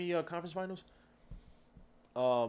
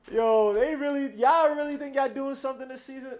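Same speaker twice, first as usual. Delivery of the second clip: high, loud, laughing jeer; a big-voiced cackling taunt at rival fans; mocking taunt; 11 semitones higher, 10 dB louder than usual. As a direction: laugh it in their faces.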